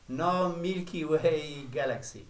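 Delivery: ordinary talk, nothing marked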